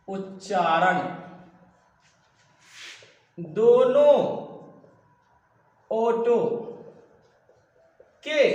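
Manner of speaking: stretched words, long silences between; word-by-word dictation. Real, not acted. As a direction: dictate it piece by piece, very slowly. Teacher dictates while writing, word by word. (शब्द खींचकर, रुक-रुककर लिखवाना)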